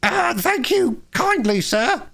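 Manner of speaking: high, slow, feeble washerwoman voice. A narrator's reading. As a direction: quaver it out weakly, faking frailty. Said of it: "said Toad in a feeble voice".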